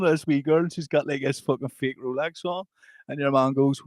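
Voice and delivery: usual speaking voice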